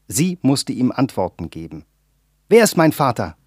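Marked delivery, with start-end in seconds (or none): 2.5-3.4 louder, higher urgent question to his mother; pressing demand. A narrator's reading. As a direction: demand it, pushing hard. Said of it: "bedrängte Kundé seine Mutter".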